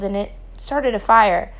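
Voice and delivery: ordinary delivery, nothing marked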